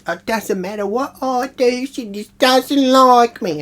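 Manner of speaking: high, slow whiny sob-story voice; mock crying complaint. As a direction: parody a sulking guest. Jill crying to Frank retold.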